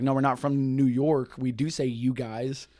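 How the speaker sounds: ordinary talk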